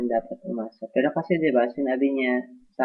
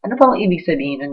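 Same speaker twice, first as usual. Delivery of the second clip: louder, higher and fast; exasperated outburst; 6 semitones higher, 8 dB louder, faster than usual. Real, not acted. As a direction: fire it off in frustration. Naiinis na tanong. (malakas at mabilis na inis)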